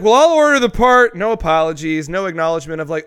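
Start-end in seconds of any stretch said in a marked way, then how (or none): 0.0-1.1 mock mechanic. Imitating the mechanic.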